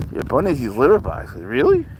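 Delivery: sudden high squeak of surprise; surprised. Real, not acted. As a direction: shoot up high in disbelief. Surprised at bunny litter boxes.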